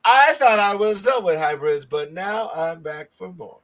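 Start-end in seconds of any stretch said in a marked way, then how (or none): none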